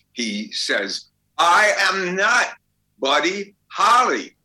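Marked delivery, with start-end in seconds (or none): 1.4-4.4 higher, louder and slow, each word hammered; exasperated emphatic denial. Retold or imitated. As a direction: spell it out loud, fed up with being mistaken. Al's third, final refusal.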